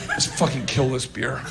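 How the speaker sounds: ordinary talk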